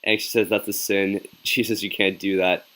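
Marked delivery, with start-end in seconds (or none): none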